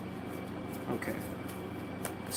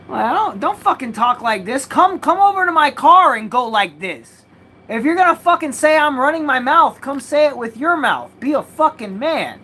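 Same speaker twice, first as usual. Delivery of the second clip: loud and high, daring him to fight; squaring up to the neighbor, heated shouting; retold angry shout; 8 semitones higher, 6 dB louder than usual. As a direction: call him out like you're ready to brawl. Retold confrontation continues.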